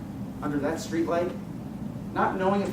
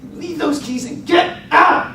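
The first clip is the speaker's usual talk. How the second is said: higher, louder angry order; growled threat; 11 semitones higher, 6 dB louder than usual. Retold or imitated. Carjacker leans across the seat ordering the driver out, angry growl. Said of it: "as he growls".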